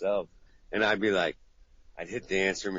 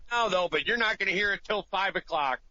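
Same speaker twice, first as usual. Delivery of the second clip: much higher sarcastic voicemail; mock pissed caller; 10 semitones higher than usual. Client's sarcastic pissed-off voicemail. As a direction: complain knowing he won't hear it.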